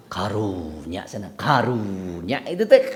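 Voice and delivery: slow, soft, low "karunya" dripping with fake pity; mock pity. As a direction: drag out "karunya" like a gossip feigning sympathy. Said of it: "penekanan gitu teh". ("karunya" pelan dan rendah, iba palsu)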